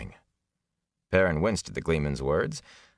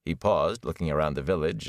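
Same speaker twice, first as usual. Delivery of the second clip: ordinary delivery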